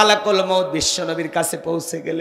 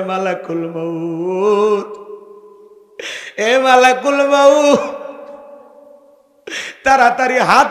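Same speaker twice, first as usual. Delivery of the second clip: acted tearful plea; 3 semitones higher, 6 dB louder than usual. Preacher voices the crying Prophet begging the angel, anguished pleading. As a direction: plead in anguish, stretching the cries. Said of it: "আমার নবী আবারও কান্দে".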